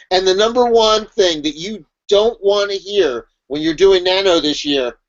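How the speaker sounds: usual speaking voice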